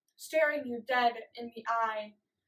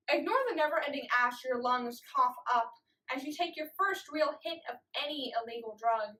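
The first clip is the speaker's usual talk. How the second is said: ordinary talk, nothing marked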